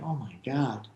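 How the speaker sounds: a hushed, starstruck gasp of words; awed hush. Retold or imitated. On meeting his idol [name], a stunned hush.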